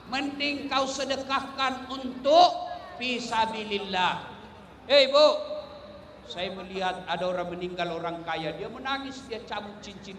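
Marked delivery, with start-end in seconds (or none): 4.9-5.3 loud call-out. Real, not acted. Calling out to women.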